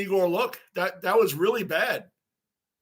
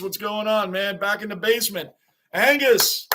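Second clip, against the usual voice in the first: usual speaking voice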